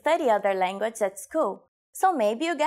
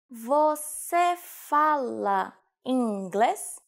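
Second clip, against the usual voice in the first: very slow, syllable by syllable; slow demonstration; much slower than usual. Shown as a demonstration. Teacher showing learners how to ask about English, very slowly. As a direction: stretch each syllable out for learners.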